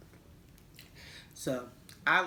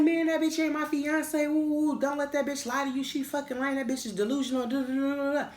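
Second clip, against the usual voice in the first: put-on frantic boyfriend denial; 10 semitones higher than usual. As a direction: squeal it like a guy caught lying. Boyfriend voiced denying the fiance.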